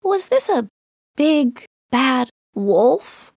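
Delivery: lightly voiced little child, rising; child asking. Little Lou asking storyteller about the wolf, lightly childlike. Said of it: "asks Lou".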